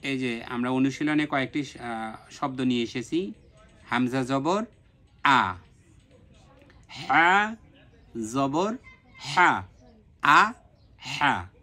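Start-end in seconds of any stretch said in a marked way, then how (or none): 3.9-11.5 slow separated syllables আ and হা; practice drill. Shown as a demonstration. Teacher models the practice drill for students to copy. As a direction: sound each harakah clearly. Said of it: "আমি অনুশীলনটা যেভাবে করি".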